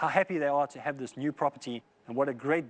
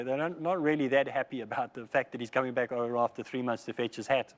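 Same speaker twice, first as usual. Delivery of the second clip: normal talking voice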